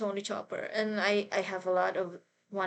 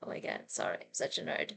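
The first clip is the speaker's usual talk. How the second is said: ordinary talk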